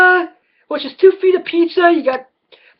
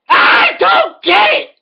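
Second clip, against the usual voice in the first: higher, slower, shouted repeat; frustrated; 8 semitones higher, slower than usual. Real, not acted. Comedian yelling his confusion about food choices, escalating shout. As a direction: escalate the line into a yell.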